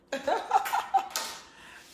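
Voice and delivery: bursting high laugh; real laughter; high loud laugh. Real, not acted. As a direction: laugh out loud at your own joke. Laughing during live banter.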